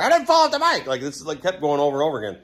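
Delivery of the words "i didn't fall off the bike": very high, protesting holler; exasperated high-pitched outburst; retold indignant yell. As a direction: mimic yourself yelling the same correction. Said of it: "I just, like, yelled that".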